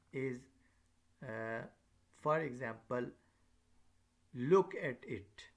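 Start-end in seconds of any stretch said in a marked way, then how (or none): 4.3-5.4 slow phrase with a strong, falling emphasis led by "Look"; stress on LOOK. Shown as a demonstration. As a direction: LOOK louder and drawn out, "at it" trailing after. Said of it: "your stress, your focus".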